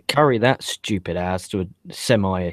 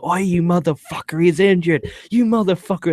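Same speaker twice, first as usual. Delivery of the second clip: higher-pitched mock yell; retold shouting; 6 semitones higher than usual. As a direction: imitate an outraged wrestler hollering. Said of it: "and starts shouting".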